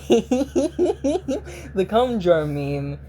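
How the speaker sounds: drawn-out words through laughter; laughing. Real, not acted. Laughing while explaining meme.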